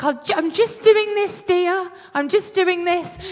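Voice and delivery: high, sing-out motherly reply; lightly acted, high-voiced brush-off; imitated mum. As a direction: play a preoccupied mum, pitched high. Voices her mum replying, unbothered.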